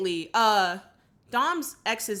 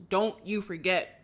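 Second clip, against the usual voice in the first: ordinary talk, nothing marked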